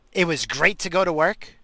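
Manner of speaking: normal talking voice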